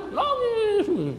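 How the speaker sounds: high, drawn-out imitation of crying; slow mock sob, held high; mock weeping. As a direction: stretch it into a hammed-up wail. Preacher mimics man bursting into tears.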